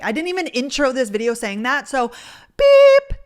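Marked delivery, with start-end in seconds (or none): 2.6-3.2 high, loud mock censor bleep; censor beep. Shown as a demonstration. Vlogger pausing her date story for an intermission, loud high mock beep. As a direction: squeal a bleep to cut the story.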